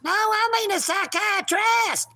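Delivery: high, whiny cat voice insisting on its word; the kitty talking back in its squeaky voice. Pretend. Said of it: "don't be using my lines there, kitty".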